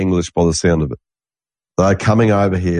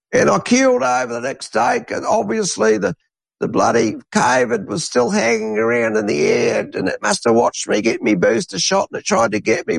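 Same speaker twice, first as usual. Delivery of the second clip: much higher, put-on old bloke's voice; mocking old Aussie bloke; 13 semitones higher than usual. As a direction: lampoon a gullible old Aussie in his eighties. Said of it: "a lot of blokes talk like this".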